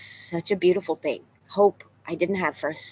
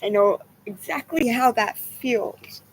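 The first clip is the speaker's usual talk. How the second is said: tearful; 4 semitones higher, slower than usual. Real, not acted. Crying while talking about loss.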